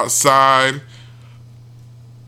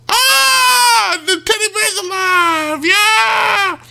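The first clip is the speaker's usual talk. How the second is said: much higher, wailing hammed-up shriek; mock terrified scream; much slower than usual. Babysitter sees the killer teddy bear, mock terrified shriek. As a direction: shriek like a horror-movie victim.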